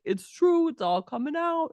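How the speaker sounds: high-pitched mock glee, as if laughing; gleeful imitation. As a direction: imitate his giddy, high delight at the news. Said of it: "like, laughing 'cause he's like".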